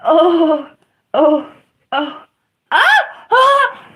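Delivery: loud "oh"s jumping to high, shouted "ah!"s; short pained cries, climbing sharply. Real, not acted. Streamer hurting as they try to move, escalating pained yelps. Crying out in pain.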